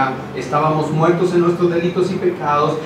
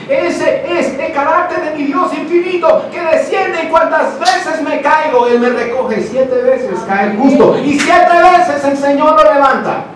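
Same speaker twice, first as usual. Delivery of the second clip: much higher, louder, joyful proclamation; fervent preaching; 9 semitones higher, 4 dB louder than usual. Acted. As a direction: preach it out with rising fire. Preaching climax.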